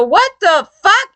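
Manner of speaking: angry outburst. Retold or imitated. Retelling furious reaction at office.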